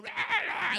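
high, loud garbled snarl; mock cursing yell. Girl running down the road cursing at pastor, mock yell. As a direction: imitate someone cursing wordlessly. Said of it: "cursing at me".

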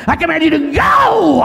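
high, loud stretched-out command; commanding shout. Shown as a demonstration. Pastor showing the congregation how to command, shouting. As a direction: stretch go into a long forceful shout. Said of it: "You have to say".

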